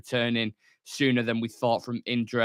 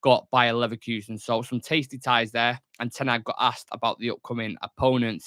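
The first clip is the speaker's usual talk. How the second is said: ordinary talk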